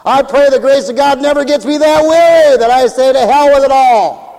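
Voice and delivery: loud, high revival preaching; full-force preacher's proclamation; fervent preaching. Real, not acted. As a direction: cry it out from the pulpit.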